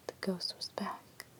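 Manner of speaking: plain reading voice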